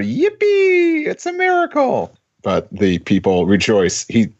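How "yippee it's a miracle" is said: put-on high, squeaky little boy yell; Anakin Skywalker. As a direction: shout it like an excited kid. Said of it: "a young Anakin Skywalker yells out".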